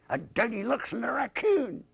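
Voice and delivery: high-pitched puppet voice; riddling dummy. Acted. Dummy answers own riddle, high puppet voice. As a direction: deliver the punchline high and comic.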